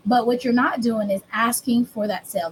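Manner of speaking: ordinary talk, nothing marked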